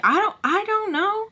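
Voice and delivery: high, helpless I don't know; teen fending off her father's question; squeaky unsure reply. Retold or imitated. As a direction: play it small and flustered. Retold own reply.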